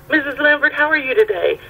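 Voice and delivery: lightly voiced judge, pitched high; imitating judge. Voices judge greeting her.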